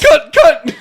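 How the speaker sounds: laughing echo. Real, not acted. Joking echo amid laughter.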